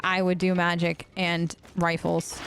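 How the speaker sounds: ordinary delivery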